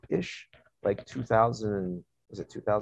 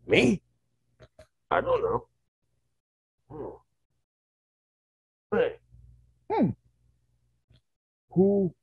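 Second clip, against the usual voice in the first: higher, halting primitive voice; ape man with some language; 8 semitones higher than usual. Retold or imitated. Two ape men asking who they are, halting primitive voices. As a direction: grunt out tiny words, puzzled, with long gaps. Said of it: "a different version of an ape man".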